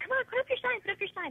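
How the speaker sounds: high-pitched coaxing, voice jumping up; urging. Retold or imitated. Mother coaxing her nervous son at the show, high urging. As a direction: urge him on in a high voice.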